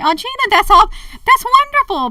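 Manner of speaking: high, loud gushing voice; mock naive viewer. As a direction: gush like a gullible fan. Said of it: "You may say".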